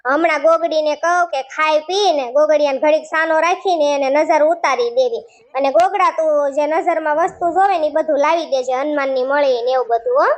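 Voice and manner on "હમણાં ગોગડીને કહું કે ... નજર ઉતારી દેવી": normal talking voice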